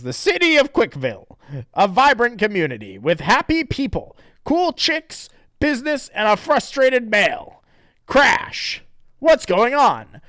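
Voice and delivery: high, loud mock-dramatic narration; hammed-up narrator. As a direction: announce it like a cheesy cartoon narrator. Comic narrator voiced.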